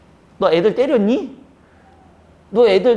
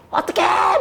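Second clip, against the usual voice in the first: high shriek at a cockroach; mock panicked fussing; 7 semitones higher, slower than usual. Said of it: "이거 호들갑은 긍정이야". (바퀴벌레에 놀란 척 높게 호들갑)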